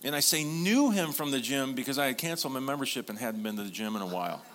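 ordinary talk

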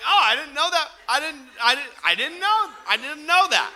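exaggerated fake surprise at the counter; mock surprise. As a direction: play it up as if amazed, stammering. Pretending surprise to the worker.

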